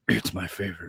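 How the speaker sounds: soft, low imitated reply; low mimicked mutter. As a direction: answer in a hushed, low mock voice. Retold answer to "Fiddler?.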